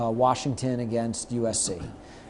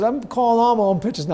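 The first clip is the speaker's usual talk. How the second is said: high excited boast; 10 semitones higher, 6 dB louder than usual. Retold or imitated. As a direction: crow about it happily. Pitcher excited.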